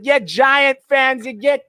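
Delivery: troll rival fans in a put-on Boston accent; mock Boston accent. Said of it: "trolled them with Boston accents".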